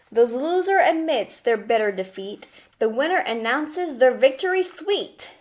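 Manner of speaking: lively storybook reading, rhyme lifted; reading a rhyming book aloud. Acted. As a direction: read it like a rhyming picture book to kids.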